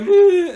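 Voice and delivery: high, loud wordless whimper; mock crying whine. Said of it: "noticed she was crying".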